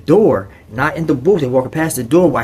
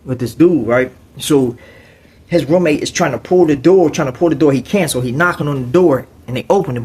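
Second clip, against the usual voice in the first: normal talking voice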